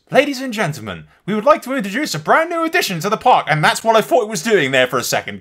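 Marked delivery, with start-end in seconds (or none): 0.1-3.4 high, loud mock announcer voice; imitated park announcer. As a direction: mimic the game's announcer at a raised pitch. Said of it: "that voice goes".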